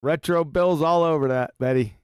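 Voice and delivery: normal talking voice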